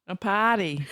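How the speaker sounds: "party" said Boston style as "pahty"; Boston accent. Shown as a demonstration. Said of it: "That's using my Boston accent".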